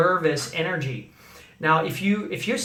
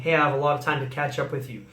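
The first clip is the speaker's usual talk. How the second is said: wrong way, rushed; 4 semitones lower, faster than usual. Shown as a demonstration. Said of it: "Didn't mean".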